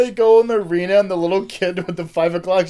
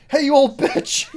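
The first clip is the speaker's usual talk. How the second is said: loud jeering shout; yelled taunt; 7 semitones higher, 6 dB louder than usual. Acted. Yelling an insult at the old lady. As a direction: hurl the insult at her.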